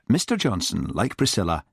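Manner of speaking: plain reading voice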